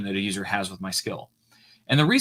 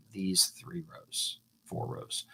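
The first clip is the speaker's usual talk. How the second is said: whisper; 4 semitones lower, 11 dB quieter, much slower than usual. Real, not acted. Muttering while reading the table.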